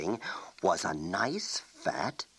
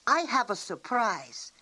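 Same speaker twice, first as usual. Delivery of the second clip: put-on high, eager girl showing off her magic; girl boasting she knows real magic; boastful girl; 14 semitones higher than usual. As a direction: pitch up, proud and excited.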